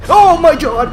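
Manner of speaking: screams then laughs nervously; frightened scream. Real, not acted. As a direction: shriek turning into a laugh. Jumped by monster.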